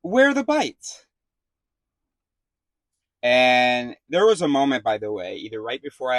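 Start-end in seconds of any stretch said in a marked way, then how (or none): 0.0-1.1 high, loud and slow, mock indignant; indignant demand. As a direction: hammer the question out, sceptical and loud. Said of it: "I just said to her".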